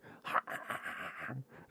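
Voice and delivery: mock shop worker's chuckle; put-on staccato laugh; imitated laugh. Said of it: "And the guy's like".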